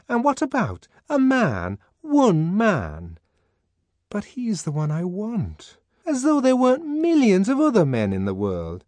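high, loud insistent arguing; voiced Fanny, insistent and pitched high. A narrator's reading. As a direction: argue her down.

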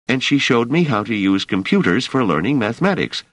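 put-on a young man pleading his case; earnest young worker. Emeril voiced by narrator.

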